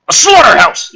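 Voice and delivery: loud, high, hammed-up announcement; mock exclamation. Host mocking the script's word choice, loud mock exclamation. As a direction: blurt it out like a big reveal.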